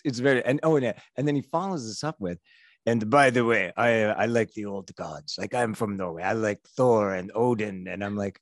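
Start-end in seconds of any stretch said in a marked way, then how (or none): 2.9-7.9 a loose mock Norwegian voice for the fan's lines; bad Norwegian accent. Comedian quoting the Norwegian fan about old gods, light Norwegian accent. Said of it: "I'm massacring this accent".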